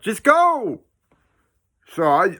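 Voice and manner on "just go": high, loud shout of go; urgent yell. Retold or imitated. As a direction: yell at him to run. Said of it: "he's like".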